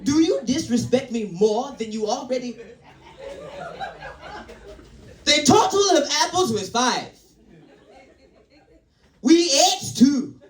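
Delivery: mock Nigerian mother teaching sums; exasperated lesson in a put-on Nigerian accent. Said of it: "like, Nigerian or some shit".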